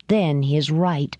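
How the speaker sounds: ordinary delivery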